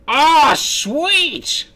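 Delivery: high, loud burst of delight; thrilled high exclamation; excited yelp. Real, not acted. Reacting to a surprise message.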